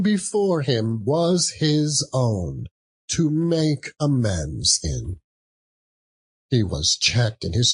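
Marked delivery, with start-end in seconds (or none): none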